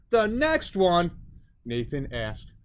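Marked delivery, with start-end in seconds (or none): 0.1-1.1 dismayed question. A narrator's reading.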